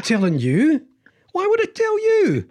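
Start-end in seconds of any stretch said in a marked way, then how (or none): 1.3-2.5 mock coy squeak. Playfully refusing to tell.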